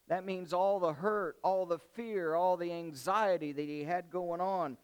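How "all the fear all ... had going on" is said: ordinary talk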